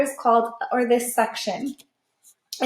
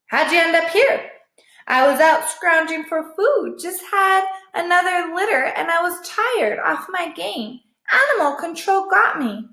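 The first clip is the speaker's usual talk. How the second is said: higher tired stray voice; lightly voiced weary mother dog; a hint of a high dog voice; 6 semitones higher than usual. A narrator's reading.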